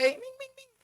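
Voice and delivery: calling the cat. Pretend.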